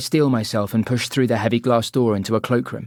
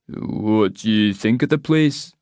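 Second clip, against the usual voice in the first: Scottish pub man; slower than usual. Acted. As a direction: lean in and drawl the question. Local man questions her.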